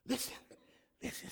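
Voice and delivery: whisper. Real, not acted. Preacher hushes again.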